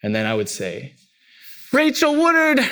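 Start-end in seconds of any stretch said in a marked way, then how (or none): 1.7-2.6 high, loud full-name shout; big-guns call. Retold or imitated. Child using mom's first name.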